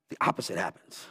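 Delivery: "the opposite happens." said low and soft; dropped low. Shown as a demonstration. Preacher drops low before repeating point, quiet. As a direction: sink "the opposite happens." to a near hush.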